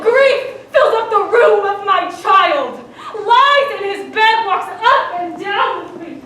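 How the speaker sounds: loud, impassioned grief; passionate cry. Acted. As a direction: pour it out at full voice. Constance defending her grief.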